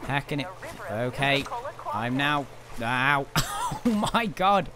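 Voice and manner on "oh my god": laughing. Real, not acted. Laughing at chaos.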